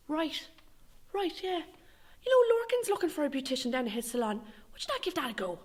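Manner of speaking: high, chirpy Irish busybody voice; high sing-song gossip voice; nosy neighbour. Retold or imitated. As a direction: play the nosy old neighbour prying sweetly. Mrs Brady suggests beautician job.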